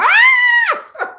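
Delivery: very high, loud fleeing shriek; hammed-up shrill scream; mock scream. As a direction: scream like someone running for her life. Mimi G running, screaming.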